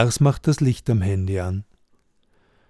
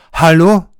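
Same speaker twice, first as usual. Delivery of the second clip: loud, higher call into the dark house; 5 semitones higher, 11 dB louder than usual. A narrator's reading. Lars calling into the dark house: loud call. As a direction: call out hallo to an empty house. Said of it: "Lars ruft".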